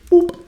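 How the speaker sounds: high, loud cartoon "Boop"; silly zap sound. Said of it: "Palpatine just appears and just goes".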